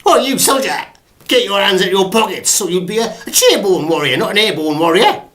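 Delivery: high, loud barking drill sergeant; Sergeant Major. Acted. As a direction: bellow it like a parade-ground sergeant major. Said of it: "Sergeant Major in the army".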